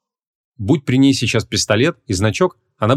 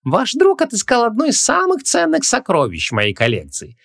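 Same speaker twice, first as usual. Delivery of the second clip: high, theatrical salesman voice; gushing shopkeeper; 14 semitones higher than usual. A narrator's reading. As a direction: exalt your treasure to the buyer. Shopkeeper gushing over a cheap amulet.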